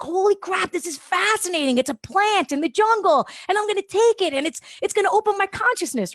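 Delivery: high, wide-eyed amazement at the book; excited. Retold or imitated. Recalling reading the book, thrilled and high. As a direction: gush about the plant like a convert. Retelling his own excitement.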